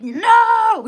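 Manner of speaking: high, loud mock refusal; defiant no. Voicing her old belief refusing to leave, loud high shout. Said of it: "old belief system's trying to stay there".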